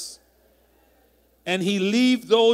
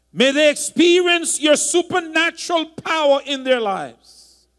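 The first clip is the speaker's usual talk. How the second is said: louder preaching prayer; prayer blessing; 4 semitones higher, 5 dB louder than usual. Real, not acted. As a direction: pray the blessing out with conviction. Pastor prays blessing over congregation.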